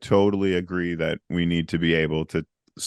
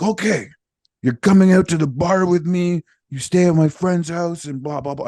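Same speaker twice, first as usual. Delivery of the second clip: excited guy; 11 semitones higher, 5 dB louder than usual. Retold or imitated. Voicing the Norwegian guy.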